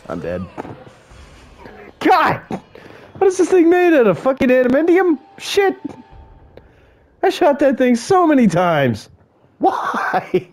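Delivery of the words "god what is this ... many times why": yelling at a monster that won't die; frustrated outburst. Real, not acted. Shot T-Rex, it won't die.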